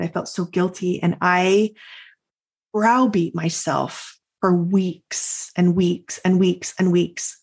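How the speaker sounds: ordinary talk, nothing marked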